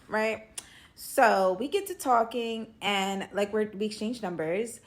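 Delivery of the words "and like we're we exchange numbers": ordinary talk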